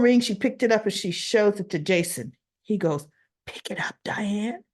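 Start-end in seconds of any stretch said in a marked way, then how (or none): 3.5-4.6 urgent hushed whisper. Retold or imitated. Jason urging Diane to answer, whispering. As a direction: breathe it so the caller can't hear.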